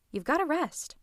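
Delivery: usual speaking voice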